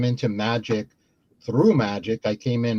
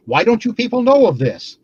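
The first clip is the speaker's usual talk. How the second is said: exasperated; 9 semitones higher, 5 dB louder than usual. Retold or imitated. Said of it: "And I was like".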